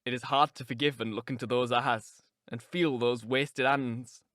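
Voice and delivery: low-pitched, grieving lover's voice; low voice of a tormented lover; anguished man. Acted. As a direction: answer her from deep in grief. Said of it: "he answered".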